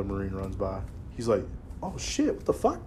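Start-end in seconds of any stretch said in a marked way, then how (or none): 1.8-2.8 shocked friend. Retold or imitated. Friend seeing Marines run PFT.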